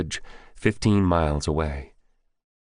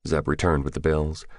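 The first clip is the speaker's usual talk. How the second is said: ordinary delivery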